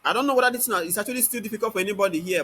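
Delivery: usual speaking voice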